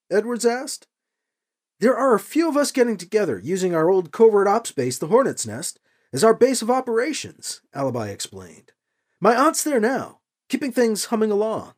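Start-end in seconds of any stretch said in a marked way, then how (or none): 1.8-11.7 higher-voiced earnest young hero; lightly voiced young rebel; young hero Alibi. A narrator's reading. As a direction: explain it, holding back anger.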